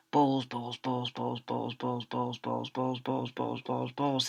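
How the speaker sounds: a soft repeated mutter of balls; teacher stuffed with balls, muttering to himself. Acted. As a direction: mumble it over and over as you hurry. Teacher muttering while waddling.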